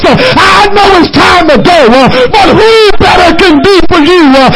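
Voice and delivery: rhythmic preaching with a gasped uh before each phrase; preaching at full force. Acted. Preacher building to a close.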